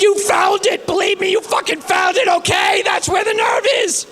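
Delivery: high strained yell; mock agony, yelling high; pained shouting. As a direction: shout it through the pain. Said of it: "I said".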